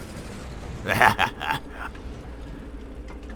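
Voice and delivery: breathless scheming snicker; sly giggle. Acted. Gamer relishing the ambush plan, sly snicker. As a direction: snicker like a plotting imp.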